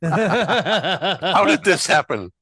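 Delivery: laughing. Real, not acted. Mock dismay at being corrected.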